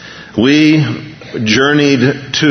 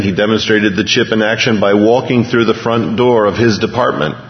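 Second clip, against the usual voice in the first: ordinary talk, nothing marked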